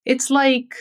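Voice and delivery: it's like left hanging at the end; open ending. Shown as a demonstration. Teacher showing it's like with an open ending. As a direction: trail off on it's like as if continuing. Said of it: "atentando pra entonação".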